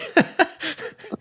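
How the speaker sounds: real laugh, high and sudden; high burst of laughter. Real, not acted. Host laughs at the guest's Birmingham summers. Laughs after surprised reaction.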